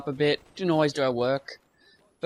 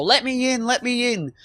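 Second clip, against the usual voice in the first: mock pleading teacher; 9 semitones higher, 4 dB louder than usual. Teacher knocking on locked door.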